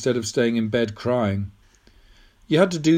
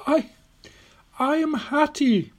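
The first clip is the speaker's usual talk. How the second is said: high, slow, deliberate declaration; patient old woman. A narrator's reading. Old woman telling Tom who she is, patient and deliberate. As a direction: state it slowly in a high voice. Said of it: "said Missus Bartholomew patiently".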